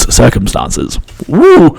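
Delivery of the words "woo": whoop. Real, not acted. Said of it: "What a sentence".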